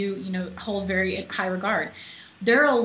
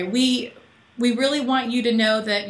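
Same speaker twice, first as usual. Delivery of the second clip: ordinary delivery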